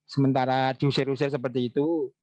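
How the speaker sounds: ordinary talk, nothing marked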